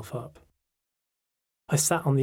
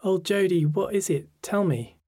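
higher, a touch of concern; gently pitched-up friend, coaxing; lightly voiced concerned friend; 7 semitones higher, 3 dB louder than usual. A narrator's reading. Friend urges her to tell, mildly concerned.

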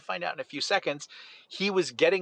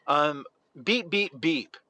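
normal talking voice

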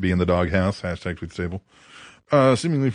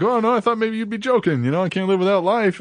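a hint of a higher, naive voice; mock innocent Hacksaw; 9 semitones higher than usual. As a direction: play dumb, lightly voiced. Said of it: "he's like".

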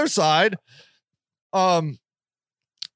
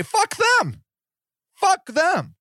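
much higher, heated dismissal; worked-up outburst; 9 semitones higher, slower than usual. Real, not acted. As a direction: spit it out, fed up. Ranting against prissy liberals.

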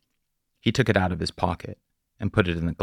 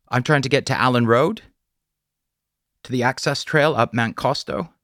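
polite stranger voiced higher, friendly; a young drifter politely asking a favour; higher, apologetic hitchhiker voice; young traveller; 6 semitones higher, 5 dB louder than usual.

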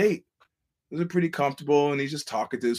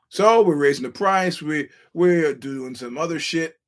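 louder mocking tycoon; mock arrogant boss; 3 dB louder than usual. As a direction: play the greedy promoter gloating at fans. Impersonating UFC boss ranting.

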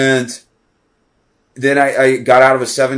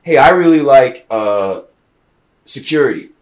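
mock voter; 2 semitones higher, 7 dB louder than usual. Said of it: "What if politics was".

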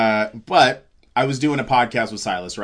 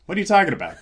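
higher-pitched, incredulous question; retold bafflement; 8 semitones higher, much faster than usual. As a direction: act out your own baffled reaction. Retold baffled reply to revelation.

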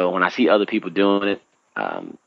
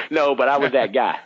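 ordinary talk, nothing marked

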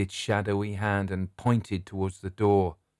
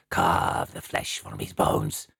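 much lower, rough savage battle cry; savage ghost cries; 11 semitones lower, slower than usual. Acted. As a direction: roar it like bloodthirsty ghosts. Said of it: "crying aloud in their own tongue".

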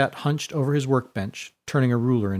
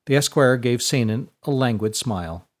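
ordinary delivery, nothing marked

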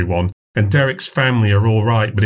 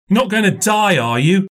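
put-on little boy voice; 10 semitones higher than usual. A narrator's reading. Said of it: "said Robbie".

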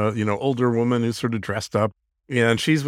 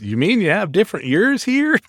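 higher, questioning mock Alabama accent; put-on Southern accent, pitched up; 6 semitones higher than usual. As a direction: imitate a baffled Alabama tourist's Southern accent. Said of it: "and he says".

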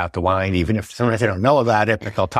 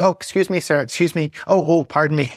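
higher, fussy over-apologetic voice; mock polite tourist; 6 semitones higher than usual. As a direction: flutter apologies like a timid visitor. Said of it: "They're like".

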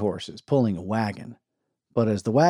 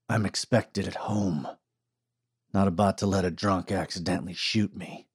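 calm quiet gunman; 2 semitones lower, 5 dB quieter than usual. A narrator's reading. Virgil voiced in story.

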